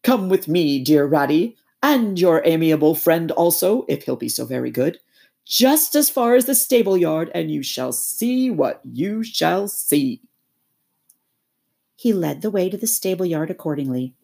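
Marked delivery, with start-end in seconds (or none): 0.0-10.2 high, loud pompous toad voice; self-important Toad holding forth, higher and louder; boastful Toad. Acted. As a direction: lord it over them like a puffed-up squire. Toad lectures his friends.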